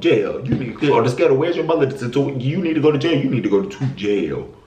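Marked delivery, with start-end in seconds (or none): none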